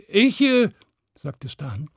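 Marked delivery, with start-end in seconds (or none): 0.1-0.7 high, hesitant stammer; flustered stammer. Acted. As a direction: falter before answering. Stan faces dragon, stammering.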